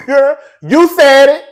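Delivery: high, loud laughing cheer; loud gleeful hype; laughing shout. Pretend. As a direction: egg them on with a hooting laugh. Hyping up messy girls.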